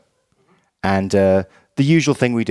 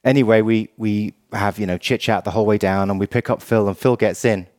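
usual speaking voice

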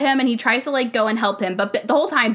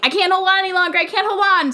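mock panic; 8 semitones higher, faster than usual. Voicing boy about to fall.

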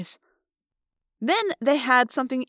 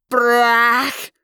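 loud crash sound; 6 dB louder than usual. Acted.

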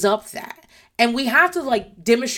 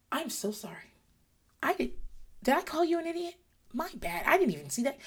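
high, soft exaggerated apology; mock contrition; 7 semitones higher, 10 dB quieter than usual. Imagined child apologizing to mommy, mock contrite. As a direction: fake a sweet, remorseful child, high and quiet.

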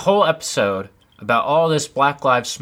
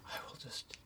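hushed whisper; 28 dB quieter than usual. Retold or imitated. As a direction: whisper to yourself. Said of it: "I just was like".

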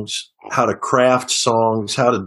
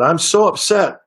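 higher put-on voice, a lightly voiced distressed driver; a hint of a foreign cabbie's dismay; upset cab driver; 8 semitones higher than usual. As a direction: mimic the driver mildly lamenting.

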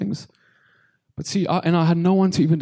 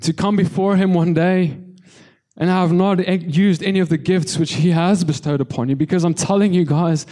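full-force preaching; 3 semitones higher, 4 dB louder than usual. Real, not acted. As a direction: preach it out with conviction.

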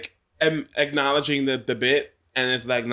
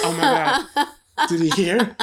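high, loud laughing panic, slow at first; panicked laugh; 10 semitones higher, 5 dB louder, slower than usual. Retold or imitated. Retelling being overheard, laughing panic. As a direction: laugh through the horror of being caught. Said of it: "I was like".

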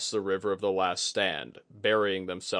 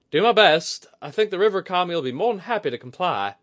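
high, loud, brisk character voice; willing, confident woman; 11 semitones higher, 10 dB louder than usual. Acted. Woman agreeing to call on the river spirit, confident.

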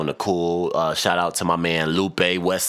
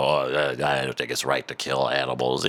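mocking her; 5 semitones lower than usual. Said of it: "Dumb bitch talking about".

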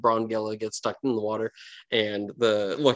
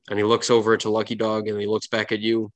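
usual speaking voice

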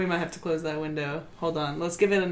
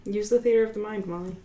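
ordinary talk, nothing marked

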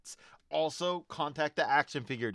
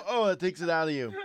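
coughing; 5 semitones higher, 5 dB louder, faster than usual. Real, not acted. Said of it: "a voice killer".

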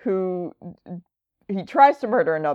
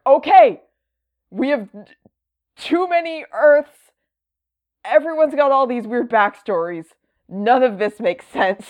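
higher-pitched, put-on declaration; hammed-up exasperation, pitched high; mock exasperated announcement; 6 semitones higher than usual. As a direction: imitate a fed-up company laying down the law. Acting out DC announcing the cleanup.

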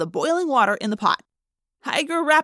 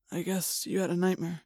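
soft and concerned; 4 semitones lower, 8 dB quieter than usual. Acted. Concerned father, softly.

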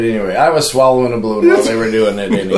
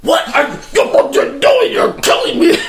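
much higher, slow, laughing shout; panicked victim. Retold or imitated. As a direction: shriek it like a man being shocked.

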